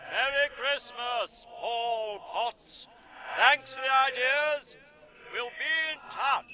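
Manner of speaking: high, drawn-out singsong farewell; distant parting call. Acted. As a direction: call it from far off, high and soft.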